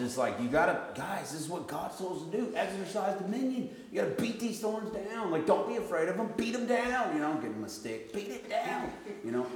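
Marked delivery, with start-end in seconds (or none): 0.4-7.1 urgent, high-pitched exhortation; rally the kids like a commander; retold rallying cry. Retold urging of his children. 8.1-8.9 high, urgent command; retold rallying cry. Retold urging of his children.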